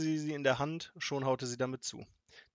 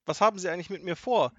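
higher-pitched accusation; indignant; 7 semitones higher, 7 dB louder than usual. A narrator's reading. Grandmother confronts her kidnapper, indignant. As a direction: demand an answer in outrage. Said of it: "Großmutter war empört".

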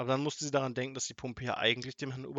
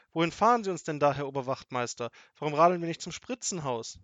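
raised, demanding questions; called out; 3 semitones higher, 7 dB louder than usual. A narrator's reading. Grandmother asks the officer where he is taking her, calling out. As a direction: call forward, wanting an explanation. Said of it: "rief Großmutter".

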